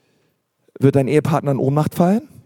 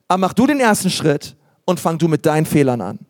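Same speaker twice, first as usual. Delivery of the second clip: ordinary talk, nothing marked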